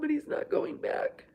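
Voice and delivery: tearful whisper. Real, not acted. Tearful relief son staying home.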